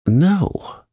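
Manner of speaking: sharp high indignant no. Acted. Mary refuses the housemaid, high indignant no. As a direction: snap it back, offended. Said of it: "answered Mary quite indignantly".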